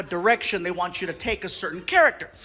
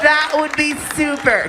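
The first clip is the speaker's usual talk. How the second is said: higher, boxy talkback voice; director over PA; 5 semitones higher than usual. Retold or imitated. Director to voice actor behind glass, tinny PA voice. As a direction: imitate a brisk director through a studio speaker. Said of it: "talk to me through a P A system".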